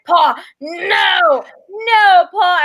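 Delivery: loud, urgent cry of no; frantic shout. Retold or imitated. To Paul on the phone, frantic shout. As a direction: stop him mid-call, yelling his name. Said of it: "I'm like".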